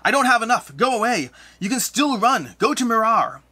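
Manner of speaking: high, loud frantic warning; screaming ghost. Acted. Ghost screaming at intruders to run, frantic high shouting. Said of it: "He turns to you screaming".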